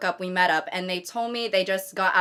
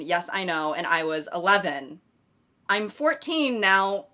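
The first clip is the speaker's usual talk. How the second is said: ordinary talk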